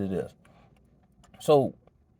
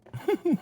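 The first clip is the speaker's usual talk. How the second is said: high laugh; 6 dB quieter than usual. Real, not acted. Laughs at own story.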